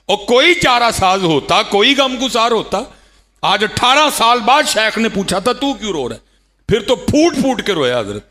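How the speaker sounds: high, loud cry of a fervent preacher; impassioned preaching. Acted. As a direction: belt it out to the crowd with fervour.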